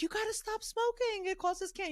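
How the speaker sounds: imitating a high, alarmed little girl; worried child. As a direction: pitch it up like a scared seven-year-old begging. Seven-year-old daughter freaking out.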